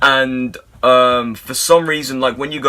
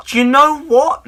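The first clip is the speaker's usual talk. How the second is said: very high, startled squeak; a high-pitched shocked squeak; squeaky high; 14 semitones higher, 5 dB louder than usual. Retold or imitated. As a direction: voice jumps way up. Retold reaction, squeaky.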